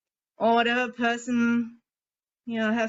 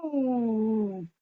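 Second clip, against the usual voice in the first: scream into a pillow. Shown as a demonstration. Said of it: "you can scream into your pillow like".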